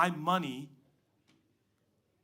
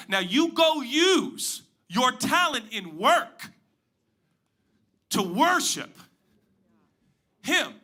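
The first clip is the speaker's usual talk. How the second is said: encouraging; 8 semitones higher, 7 dB louder, slower than usual. Retold or imitated. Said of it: "I was encouraging some of them football players".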